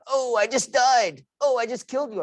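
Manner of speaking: dad mimicking teenagers calling out game events; imitating his kids. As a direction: parody the kids mid-game. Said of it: "I'm listening to my kids".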